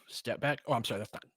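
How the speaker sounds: ordinary talk, nothing marked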